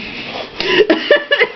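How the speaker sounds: airy giggle up high; high breathy laugh. Real, not acted. Reader amused by the kids' brawl. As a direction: break into a quiet laugh.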